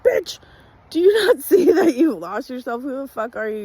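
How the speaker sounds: loud, high furious outburst at herself; sudden shrieking self-reproach; scolding inner voice. Retold or imitated. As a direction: yell at yourself like a fed-up friend. Inner voice scolding her, shouting.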